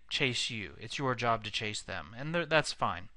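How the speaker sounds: ordinary talk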